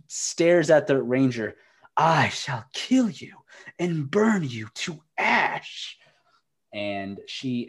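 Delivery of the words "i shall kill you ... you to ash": menacing witch. Acted. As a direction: threaten slowly like a dark sorceress. Witch threatens ranger.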